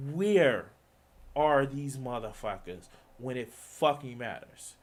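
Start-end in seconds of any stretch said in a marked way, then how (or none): none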